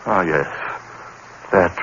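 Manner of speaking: ordinary delivery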